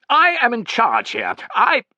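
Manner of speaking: high, loud, imperious voice; angry assertion of rank. A narrator's reading. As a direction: pull rank at full voice. Commander angrily pulling rank, cut off.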